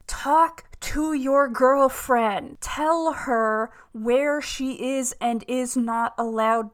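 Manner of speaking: slow, loud, word-by-word lecture; emphatic scolding. Pretend. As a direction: drive each word home, loud and slow. Scolding the character with blunt advice.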